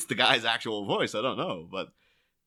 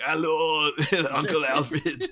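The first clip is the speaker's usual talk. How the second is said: higher, louder, laughing; a bright, laughing hello to Alfred; lightly voiced, gushing greeting; mock cheery greeting; 6 semitones higher, 5 dB louder, slower than usual. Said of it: "and it's like".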